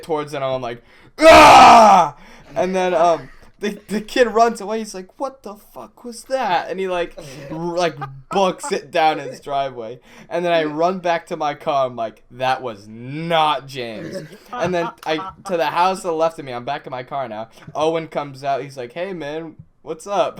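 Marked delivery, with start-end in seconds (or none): 1.2-2.2 a loud, high mock yell at the kid. Telling friends how he yelled at a stranger, loud high mock yell. 2.6-20.4 raised voice, higher and louder, storytelling; louder, excited retelling. Retold or imitated. Telling friends the mix-up story, louder and higher.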